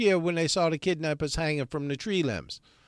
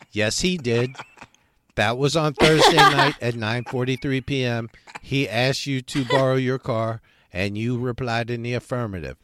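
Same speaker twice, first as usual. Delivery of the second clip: lightly voiced smug assistant, louder; a hint of a prim digital assistant; Siri voice; 5 semitones lower, 4 dB louder than usual. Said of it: "Siri chimed in".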